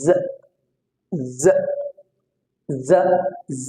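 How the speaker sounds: "জ" as a long buzzed z with a brief vowel; isolated z sound. Shown as a demonstration. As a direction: buzz each "জ" separately like z. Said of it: "এটা sound হলো". (z-এর মতো গুঞ্জন করে "জ")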